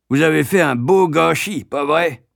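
mocking, high-pitched reproach; scornful carriage driver. A narrator's reading. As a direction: rub it in like a cross carter.

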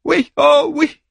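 wife yelping, half crying half laughing. Acted. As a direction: yelp it out, very high. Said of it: "glapit Andromaque".

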